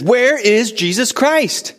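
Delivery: high, loud, slow repeated question; shouting the question again, thinking he was misunderstood. Retold or imitated. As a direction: call it out so the whole room hears. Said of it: "so he yells it even louder".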